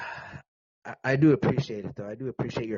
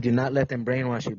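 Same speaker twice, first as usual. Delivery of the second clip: usual speaking voice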